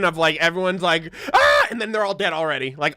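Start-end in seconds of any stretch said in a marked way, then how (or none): 1.3-1.7 short high yelp of panic; a quick squeal like the crowd; mock scream. Everyone screaming in the scene.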